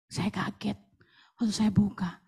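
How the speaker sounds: whispered suspense; softly whispered recollection. Real, not acted. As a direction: hush as she opens it. Recounting the surprise.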